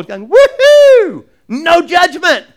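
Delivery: high, loud whoop then slow cheer; hammed-up party shout of relief; mock celebration. Ninevites cheering that judgment was cancelled, hammed-up high whoop.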